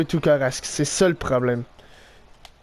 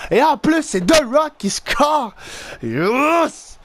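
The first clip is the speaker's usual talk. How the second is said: high, drawn-out celebratory cry; elated outburst; 6 semitones higher, slower than usual. Real, not acted. Player thrilled that The Rock scored, elated. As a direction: stretch out the scorer's name in triumph.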